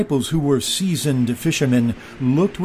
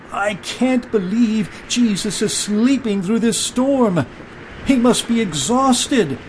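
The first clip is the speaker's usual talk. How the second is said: high-pitched exclamation over the storm; amazed and calling out, higher voice; incredulous disciple; 8 semitones higher than usual. A narrator's reading. Disciple to crewmates about Jesus sleeping through the storm, incredulous. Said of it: "one disciple said".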